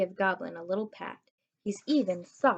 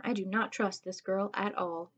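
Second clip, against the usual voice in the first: ordinary delivery, nothing marked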